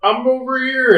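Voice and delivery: high, loud mock call; Gordon. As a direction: call out as if from round the corner. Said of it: "And then Gordon said".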